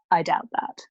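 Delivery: a lightly voiced priest, low and pleasant with hidden dislike; polite calm masking contempt, only a hint of character voice. A narrator's reading. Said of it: "His voice was low and pleasant".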